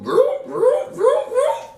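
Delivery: high laughing vroom vroom; imitated engine noise. Mechanic cuts the boot off, playful high vroom noises. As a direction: buzz it like a power tool.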